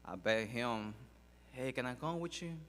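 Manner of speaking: ordinary delivery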